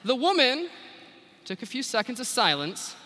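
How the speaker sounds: ordinary delivery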